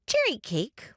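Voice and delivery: put-on child ghost offering treats. A narrator's reading. Child ghost listing cakes for guests, eagerly offering.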